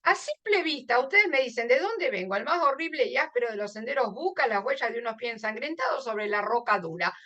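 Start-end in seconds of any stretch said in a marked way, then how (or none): 1.7-7.1 fast, run-together reading of the verse; verse skimmed in one breath; quick read-through. Acted. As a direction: rattle the lines off without pausing. Quick at-a-glance rereading of verse.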